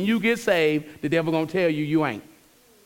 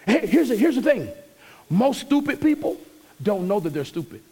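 louder, higher preaching push; 8 semitones higher, 3 dB louder than usual. Acted. Pastor exhorting the church about correction, fervent preaching. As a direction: drive the point home from the pulpit.

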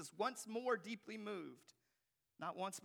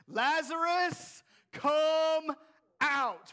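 slow, high, full-force call; loud commanding voice; 9 semitones higher, 15 dB louder, much slower than usual. Acted. Preacher as Jesus calls Lazarus from the tomb, shouted command. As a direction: shout it out word by word with authority. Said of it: "He says in a loud voice".

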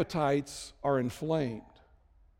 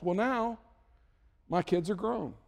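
ordinary talk

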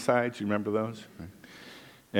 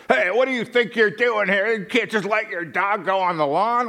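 grumpy worker; 9 semitones higher than usual. Retold or imitated. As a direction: scold a stranger in a high nagging voice. Angry worker confronting speaker.

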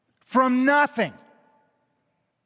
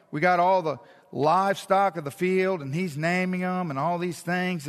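ordinary delivery

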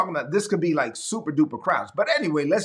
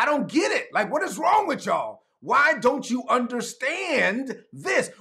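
much higher, urgent, with slow stretches; exasperated preaching; 8 semitones higher than usual. Real, not acted. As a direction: plead in disbelief with the congregation.

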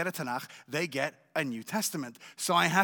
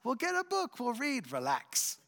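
dismissive wife; 7 semitones higher than usual. Retold or imitated. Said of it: "And she said".

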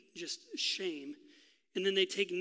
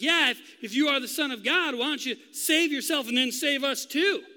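loud, high sneering challenge; mocking thief; 6 semitones higher, 8 dB louder than usual. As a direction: taunt him to save himself. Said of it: "He mocks him too".

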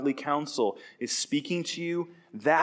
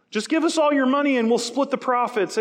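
mock robber calling out; 11 semitones higher, 6 dB louder than usual. Robber openly recruiting in the city gates, hammed-up loud call. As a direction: shout it out to the whole square like a brazen robber.

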